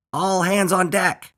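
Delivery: slow, high, loud captain's bellow; drawn-out high pirate call. A narrator's reading. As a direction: bellow it out to the whole crew. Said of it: "bellowed Earl".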